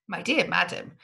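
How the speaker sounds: usual speaking voice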